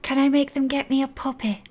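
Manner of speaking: put-on high-pitched wheedling child; eager child. Child wanting magic to win a puppy, high and eager. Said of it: "She's like".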